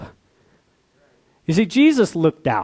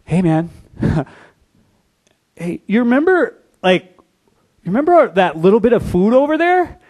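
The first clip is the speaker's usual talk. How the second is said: eager reminding; 6 semitones higher, 4 dB louder than usual. Retold or imitated. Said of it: "they go".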